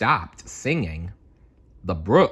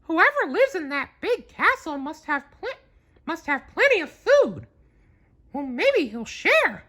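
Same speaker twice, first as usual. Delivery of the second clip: very high squeaky cartoon mouse; hopeful tiny squeak; Mickey voice. Mickey hoping the castle owner shares food. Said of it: "cried Mickey".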